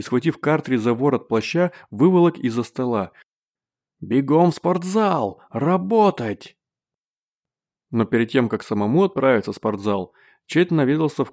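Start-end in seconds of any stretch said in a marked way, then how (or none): 4.0-6.6 ordering shout. A narrator's reading. Coach drives player to gym.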